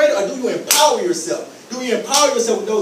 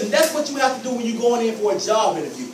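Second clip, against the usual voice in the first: ordinary talk, nothing marked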